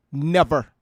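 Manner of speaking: a single loud, final word; loud, certain never; emphatic denial. Real, not acted. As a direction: shut the point down hard.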